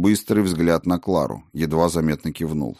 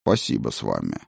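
low, poker-faced dragon; dry, expressionless thanks; deadpan; 11 semitones lower, 5 dB quieter than usual. Acted. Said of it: "с непроницаемым лицом".

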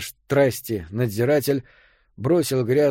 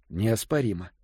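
ordinary delivery

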